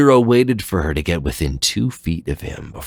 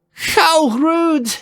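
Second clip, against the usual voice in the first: high, loud offended cry; indignant cry; 4 dB louder than usual. Acted. Leviathan crying out at Zero's blow, indignant. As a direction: shriek at the insult. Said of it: "she cried, indignant".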